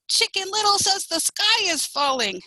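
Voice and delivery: high, excited chicken announcement; mild storybook shout. A narrator's reading. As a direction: call it out high, spreading the news. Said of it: "the chickens shouted".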